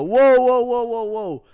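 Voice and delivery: high, drawn-out cry while toppling; startled whoa. Retold or imitated. Man losing his balance in a packed crowd, startled. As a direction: yelp a string of startled whoas. Loses balance after being shoved.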